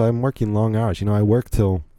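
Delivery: normal talking voice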